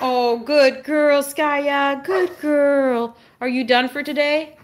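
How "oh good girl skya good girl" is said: high, drawn-out praise; praising pet talk. Real, not acted. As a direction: praise the dog slowly, pitched up. Praising the dog.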